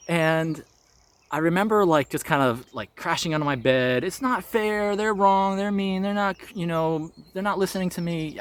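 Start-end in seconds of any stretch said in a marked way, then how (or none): 4.0-8.4 put-on high, whiny kid throwing a fit; wailing child voice, it's not fair; child's tantrum. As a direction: sulk it out like an upset little kid. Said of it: "I'm just throwing a child tantrum".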